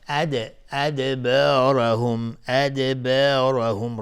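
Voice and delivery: slow, loud ادبارهم with a bounced د; qalqala. Shown as a demonstration. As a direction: bounce off the still د in ادبارهم, drawing out the word.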